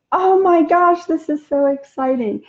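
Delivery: excited gush. Pretend. Greeting a stranger with delight, loud excited gush. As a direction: gush like you just met a hero.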